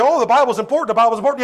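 mock-pious high singsong; a light imitation of a complacent believer; dismissive believer quote. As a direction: brush it off like a lip-service churchgoer. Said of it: "those of us that believe this book would say".

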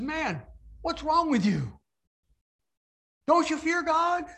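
a thief rebukes his mocking friend, urgent and high. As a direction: rebuke the friend, rising on Don't. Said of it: "he rebukes his friend".

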